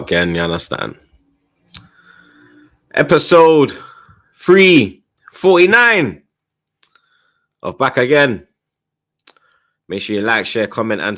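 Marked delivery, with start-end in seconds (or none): 2.9-8.5 big drawn-out show intro; announcer voice. Acted. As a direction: announce the episode number.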